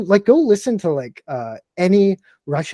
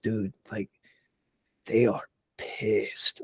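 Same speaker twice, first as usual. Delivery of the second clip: hushed slow report; 4 semitones lower, 9 dB quieter, slower than usual. Retold or imitated. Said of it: "he's like".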